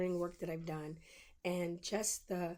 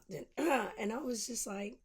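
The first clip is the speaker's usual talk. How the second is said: ordinary delivery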